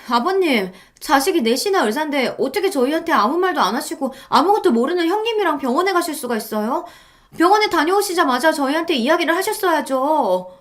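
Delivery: high-pitched, aggrieved whine; resentful complaint. A narrator's reading. Daughter-in-law blaming father-in-law for secrecy, resentful. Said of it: "시아버지께 원망 섞인 소리를 해댔습니다".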